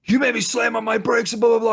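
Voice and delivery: high, loud, fast imitation of a ranting lady; mock scolding woman. Retelling the angry driver's rant to a friend, high loud mock scolding. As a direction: caricature her rant, shrill and rushed.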